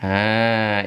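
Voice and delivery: ordinary delivery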